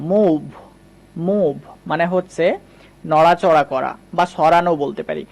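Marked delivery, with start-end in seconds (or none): 0.1-0.4 মুভ with a u vowel; correct pronunciation. Shown as a demonstration. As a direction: say মুভ with a long u. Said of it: "এটা উচ্চারণ হয়ে যাবে এবার উ দিয়ে".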